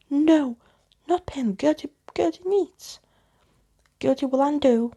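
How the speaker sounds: put-on high, loud childish pleading; shrill ghost girl plea. Ghost girl begs not to lose her pen, high and loud. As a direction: beg like a frantic little ghost.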